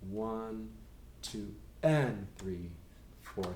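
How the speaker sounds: slow "two, and three" stressing the "and"; slow, deliberate count with "and" between two and three. Shown as a demonstration. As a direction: count it out slowly, leaning on "and". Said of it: "It's gonna be on the and of two".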